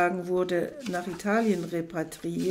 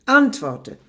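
louder, higher barked command; furious demand; 4 semitones higher, 10 dB louder than usual. Acted. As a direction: bark it harder. Kick, demand repeated.

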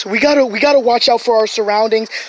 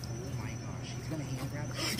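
whispered, much softer and faster; whispering; 14 semitones lower, 24 dB quieter than usual. Real, not acted. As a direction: breathe it out so the snake won't hear. Sneaking up on a python.

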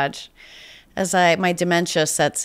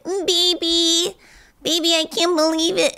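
put-on baby voice; 12 semitones higher than usual. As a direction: coo it up high like talking to a baby. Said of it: "that baby voice thing".

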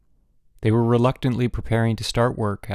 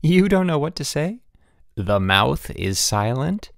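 teasing question, slowing into mock wonder; amused teasing; 5 semitones higher than usual. A narrator's reading. Kathy teasing speechless Bruno, amused teasing. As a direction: start high, then draw out the mock amazement. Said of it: "Kathy laughed".

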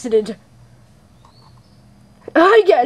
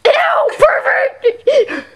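high slow grossed-out squeal; disgusted shriek; 12 semitones higher, much slower than usual. Acted. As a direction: eww it out.

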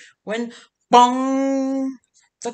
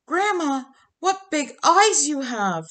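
put-on higher, innocent little-girl voice; wide-eyed child asking grandma, pitched up; 7 semitones higher, 3 dB louder than usual. Red Riding Dog greets grandma.

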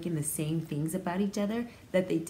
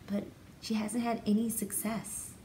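usual speaking voice

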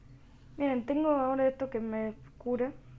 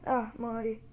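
usual speaking voice

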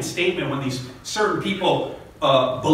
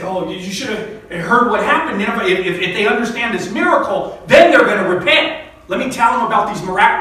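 higher, louder, climbing excitement; eager Jonah; 6 semitones higher, 6 dB louder than usual. Acted. Jonah imagined bragging to Nineveh, excited and rising loud. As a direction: gush about the miracle, building to loud.

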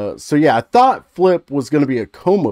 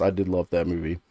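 usual speaking voice

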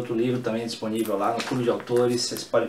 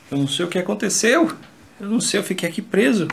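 higher, fast worried voice; 6 semitones higher, faster than usual. Acted. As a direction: rush it like you're trapped. Trapped in dark, acting panic.

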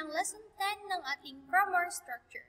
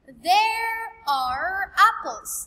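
slow emphatic example; 3 semitones higher, 7 dB louder, much slower than usual. Shown as a demonstration. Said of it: "another example here".

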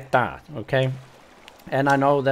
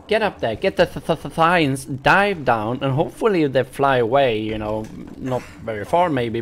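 usual speaking voice

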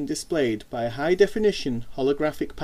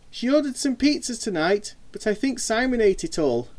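sly grin; 8 semitones higher than usual. Acted. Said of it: "she said with a grin".

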